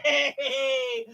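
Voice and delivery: high cheering yay. Real, not acted.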